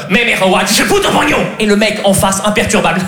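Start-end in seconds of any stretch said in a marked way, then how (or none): 0.1-1.4 imitation angry Chinese gibberish; hammed-up mock Chinese outburst. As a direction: bark out fake Chinese, furious. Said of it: "Le chinois énervé".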